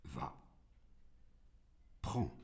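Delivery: very soft, deep single word; hushed, unmoved deity; God's curt grant. A narrator's reading. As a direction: grant it under your breath, low. God grants again.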